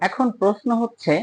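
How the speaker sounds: ordinary talk, nothing marked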